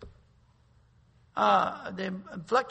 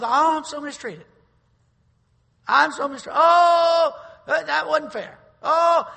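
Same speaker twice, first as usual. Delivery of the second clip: high, whiny mock complaint, rising to a cry on the last Oh; mock self-pity whine; 8 semitones higher, 11 dB louder than usual. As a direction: ham up a self-pitying victim moaning. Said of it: "He's talking about feeling sorry for yourself".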